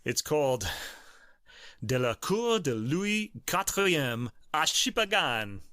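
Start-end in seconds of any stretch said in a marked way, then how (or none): 1.8-5.6 halting French read. Real, not acted. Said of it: "Chipagan came out more Italian".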